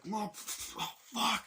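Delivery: high, strained action-hero cursing; panicky mock cries. Man escaping under fire, panicky high cursing.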